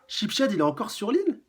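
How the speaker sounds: crier la question bien plus haut et plus fort; découvrir avec effroi qu'il est encore là; stupeur alarmée. Acted. (question affolée d'un personnage choqué)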